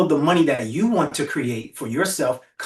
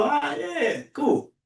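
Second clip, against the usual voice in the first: mock pleased; 10 semitones higher, much slower than usual. Feeling after being thanked.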